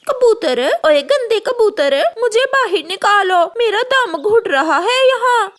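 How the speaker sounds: trapped calling out. Acted. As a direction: yell to be let out, panicking. Trapped crow calls the pigeon. (ऊँची घबराई हुई पुकार)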